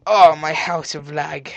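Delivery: slow, loud drawn-out groaning words; loud lament. Pretend. Player facing his lagging house, loud slow lament. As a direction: draw it out aloud, slowly. First take, voiced aloud.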